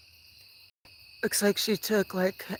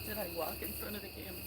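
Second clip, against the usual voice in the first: fast, very soft mutter; worried under-the-breath question; muttered worry; 16 dB quieter, much faster than usual. Real, not acted. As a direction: mutter it to yourself quickly.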